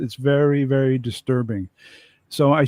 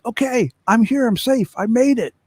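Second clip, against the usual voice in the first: higher, relieved call across; relieved soldier calling back; 9 semitones higher than usual. Retold or imitated. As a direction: shout back that he made it. Soldier reports reaching the trench.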